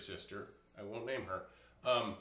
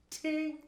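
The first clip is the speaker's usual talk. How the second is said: high sung ting; ting sound effect. Shown as a demonstration. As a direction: chime it out high. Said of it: "You don't actually have to do the ting".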